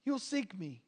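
quiet repeat. Shown as a demonstration. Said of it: "And you will seek me".

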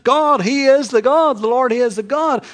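loud, high ringing cry of praise; crowd acclaiming God. Acted. The nation crying out to God on the mountain, loud. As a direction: shout it like a whole nation.

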